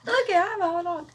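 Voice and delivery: imitating Jessie. Friend teasingly copies Jessie's hot dog boast. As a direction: tease her by copying.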